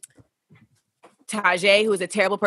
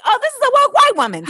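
much higher and louder, a quick caricature; mocking high voice; 8 dB louder, faster than usual. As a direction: squeal it out like a parody. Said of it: "I was like".

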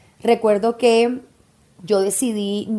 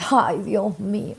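slow, drawn-out lament; sighing dismay; slower than usual. Real, not acted. Mother recalling chickenpox in pregnancy, slow sighing dismay. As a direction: let it out as a weary sigh.

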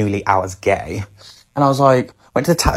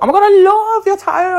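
much higher, gushing quote; gushing girl; 4 dB louder than usual. Retold or imitated. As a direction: squeal it like an excited fan.

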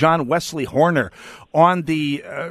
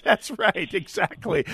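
laughing; 5 semitones higher, 4 dB quieter than usual. Real, not acted. Laughs agreeing.

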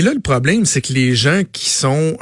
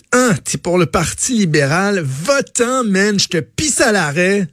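higher, mock Plateau Mont-Royal accent, telling them off; hammed-up Montreal Plateau accent brushing off canvassers; Plateau guy accent; 5 semitones higher, slower than usual. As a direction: imitate the rude Plateau guy, a bit slower. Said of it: "il y a un gars sur le plateau".

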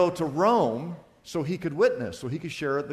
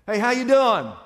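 friendly greeting; 8 semitones higher, 8 dB louder than usual. Retold or imitated. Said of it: "someone comes up to you and says this".

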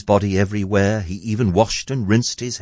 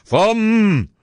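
loudly muttering giant; 4 dB louder, much slower than usual. A narrator's reading. Giant sniffing while Jack hides beneath, loudly muttering giant. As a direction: rumble it out slowly as a huge giant. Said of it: "muttering loud".

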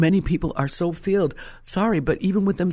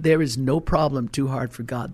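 ordinary delivery

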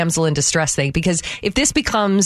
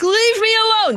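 much higher pitched mock cry of leave me alone; mock-exasperated shout at hovering clerks; imitated exasperated outburst. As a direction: fling it out, fed up and pestered. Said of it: "It's like".

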